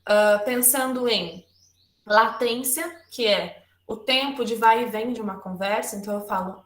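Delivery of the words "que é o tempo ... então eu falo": ordinary talk, nothing marked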